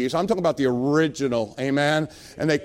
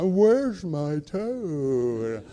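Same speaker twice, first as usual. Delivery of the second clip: very slow, drawn-out groggy slur; going under sedation; much slower than usual. Retold or imitated. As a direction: let the words sag as the anesthetic takes hold. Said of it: "some nurse stuck a needle in my arm".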